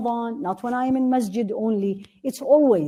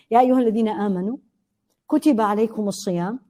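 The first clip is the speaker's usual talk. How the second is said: brisk recited verse, slightly raised; Quran recitation; much faster than usual. Acted. Quotes the fasting verse.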